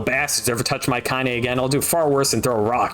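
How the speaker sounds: usual speaking voice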